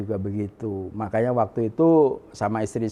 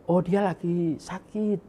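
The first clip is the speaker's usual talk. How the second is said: higher, drawn-out voice for the other person; slow, raised-pitch imitation of a caller; retold phone reply; 7 semitones higher, slower than usual. Relative on the phone passing on news of illness, imitated reply.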